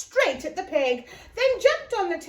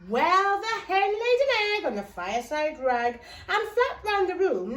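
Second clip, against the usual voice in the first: plain reading voice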